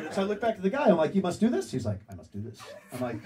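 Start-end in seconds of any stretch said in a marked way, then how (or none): 1.1-1.7 rising, louder disbelief; louder echo with a rising tune; incredulous question. Retold or imitated. Incredulous echo of his line. 2.1-2.6 lower, quieter, matter-of-fact; softer lower reply; flat insistence. Retold or imitated. Stranger's calm insistence.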